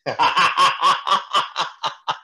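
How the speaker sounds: loud amused laugh. Real, not acted. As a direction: laugh out at the joke. Laughs at own joke.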